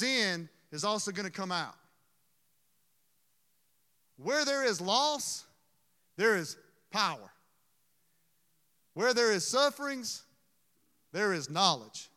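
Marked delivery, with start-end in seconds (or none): 4.2-12.1 preaching. Acted. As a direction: preach it out, each phrase lifted. Preaching a quoted maxim.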